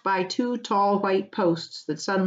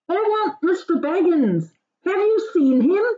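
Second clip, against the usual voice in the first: much higher, louder disguised rider voice; muffled stranger; 11 semitones higher, 5 dB louder than usual. Acted. Merry muffled in a scarf asks for Baggins. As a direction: hide your face in a scarf and demand. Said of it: "said a muffled voice".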